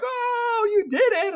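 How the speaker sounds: high, loud retold cheer; excited mock scream of victory; friends screaming in joy. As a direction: imitate friends cheering the win. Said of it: "they're, like, screaming in my ear".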